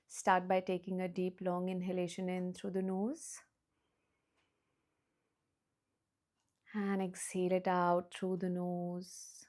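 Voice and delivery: soft slow meditation voice; hushed calm breathing guidance; guided meditation. Acted. Guides breathing, eyes closed.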